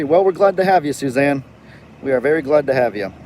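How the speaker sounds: normal talking voice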